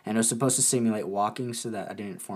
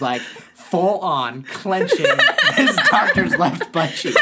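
laughing; 8 semitones higher, 8 dB louder, much slower than usual. Real, not acted. Laughing at his own story.

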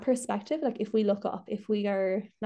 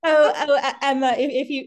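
laughing; 4 semitones higher, 8 dB louder than usual. Real, not acted. Laughing with crying guest.

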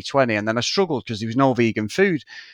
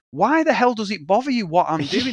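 retold defiance; 7 semitones higher than usual. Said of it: "I remember saying to one of the lads".

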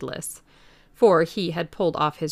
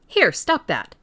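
sharp command; 3 semitones higher, 7 dB louder than usual. A narrator's reading. Constable seeing him strip.

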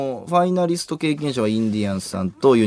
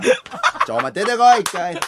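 loud, high comic order; hammed-up host calling offstage; mock summons; 8 semitones higher, 3 dB louder than usual. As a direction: call someone out in mock exasperation. Plays host summoning the act.

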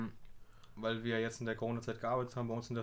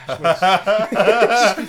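laughing through the words; words breaking into laughter; 7 semitones higher, 18 dB louder, much slower than usual. Real, not acted. Laughs at Kevin Hart joke.

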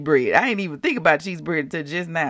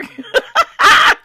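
high, loud burst of laughter; 8 dB louder than usual. Real, not acted. Laughing at her stock prophecy, loud high laugh. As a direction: crack up loudly. Laughs at own retold scene.

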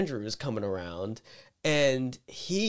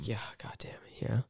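whisper; 2 semitones lower, 10 dB quieter than usual. Real, not acted. Whispered reply to co-host.

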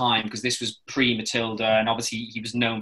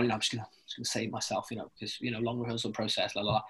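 mumbled lazy excuse; 12 dB quieter, faster than usual. Retold or imitated. Imagined lazy actor saving himself.

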